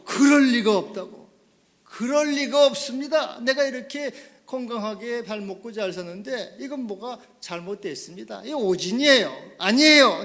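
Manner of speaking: loud, high insistent refusal to believe; shouting it can't be true; loud denial. Retold or imitated. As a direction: protest loudly that it cannot be true. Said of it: "부정".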